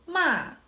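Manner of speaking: "ma" starting high and falling sharply; fourth tone. Shown as a demonstration. Teacher shows the fourth tone, "ma" high then falling. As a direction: drop "ma" from top to bottom. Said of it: "from the highest part to the".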